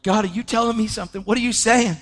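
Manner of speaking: high, urgent, quick questions; reliving astonished pleading; bewildered questioning. Retold or imitated. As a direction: press God for an answer, baffled. Questions God about repeated dream.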